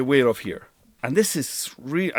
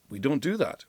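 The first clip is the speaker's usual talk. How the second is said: normal talking voice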